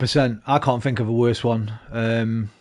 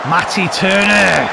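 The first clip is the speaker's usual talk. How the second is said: loud; 5 semitones higher, 6 dB louder, slower than usual. Real, not acted. Keeper makes a save.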